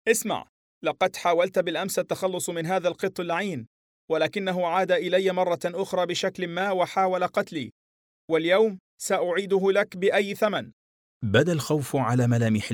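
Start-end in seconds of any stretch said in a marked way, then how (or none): 0.1-10.7 menacing, much higher furious threat; berserk rage. A narrator's reading. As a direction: lean in and threaten him, unhinged. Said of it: "بشكل مخيف وغاضب".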